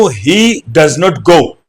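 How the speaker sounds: "He does not go" said very slowly, word by word; slow demo. Shown as a demonstration. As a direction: spell the sentence out slowly for students. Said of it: "अगर मैं बोल दूं". ("He does not go" बहुत धीरे, एक एक शब्द)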